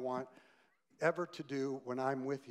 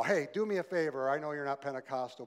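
ordinary delivery

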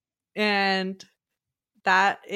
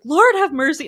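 exasperated cry; 7 semitones higher, 8 dB louder than usual. Retold or imitated. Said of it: "she's like".

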